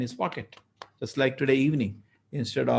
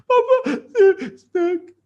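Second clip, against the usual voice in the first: put-on tearful child; 9 dB louder, much slower than usual. Crying boy to father.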